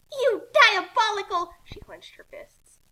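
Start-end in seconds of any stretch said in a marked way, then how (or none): 0.1-1.5 loud, high, slow insult; furious insult. Acted. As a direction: spit the insult at him. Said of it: "angry insult".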